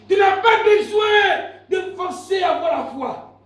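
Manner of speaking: loud, high-pitched sermon cry; full-force preaching. Real, not acted. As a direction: proclaim it to the whole church. (prédication enflammée, criée)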